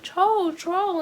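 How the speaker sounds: mock yelling. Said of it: "yelling his name".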